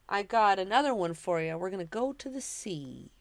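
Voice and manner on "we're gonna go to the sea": normal talking voice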